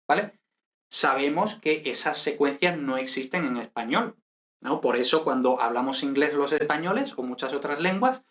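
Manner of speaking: ordinary talk, nothing marked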